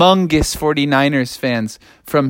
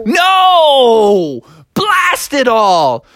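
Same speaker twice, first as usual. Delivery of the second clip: dramatic despair; 10 semitones higher, much slower than usual. Acted. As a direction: ham up the despair. Froggy's despair.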